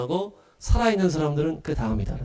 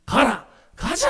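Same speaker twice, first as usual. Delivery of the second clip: high order and higher rallying cry; commanding calls; 10 semitones higher, slower than usual. Acted. The Lord's return with trumpets, high battle calls. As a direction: call the command, then rise to answer. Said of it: "나팔 소리와 함께".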